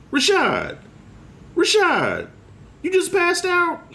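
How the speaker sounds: high, incredulous woman's voice; mocking his baby mama. Ex calling to him on the floor, high mock disbelief. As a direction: play her as shrill and disbelieving. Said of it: "My baby mama".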